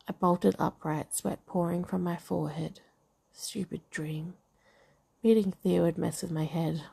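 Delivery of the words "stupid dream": muttered. Acted. Muttering to herself after waking.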